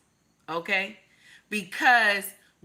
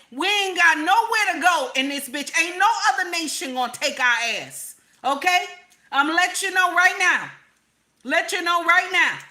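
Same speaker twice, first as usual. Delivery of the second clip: higher and louder, fired up; heated rant; 7 semitones higher, 5 dB louder than usual. Real, not acted. Warning viewers about America, shouting higher and louder. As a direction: raise the voice and preach it hard.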